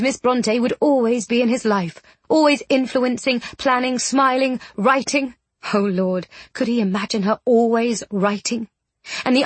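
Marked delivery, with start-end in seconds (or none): none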